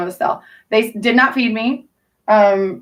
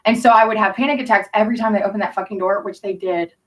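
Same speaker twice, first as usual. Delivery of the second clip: ordinary delivery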